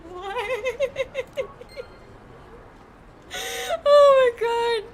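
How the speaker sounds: high, loud laughing; laughing hard. Real, not acted. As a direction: crack up helplessly. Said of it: "brought me a remarkable amount of joy".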